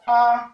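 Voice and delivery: A on a level middle tone. Shown as a demonstration. Teacher showing the tones, A on mid tone. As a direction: hold A evenly, moderately high. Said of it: "ton moyen".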